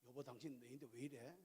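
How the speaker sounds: hushed affectionate aside; soft fond question. Retold or imitated. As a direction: ask her gently in bed. Husband gently asks wife.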